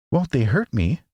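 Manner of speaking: anxious. A narrator's reading. Dorothy asking the Queen about monkeys, mildly anxious. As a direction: ask it a little worried. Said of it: "asked the girl anxiously".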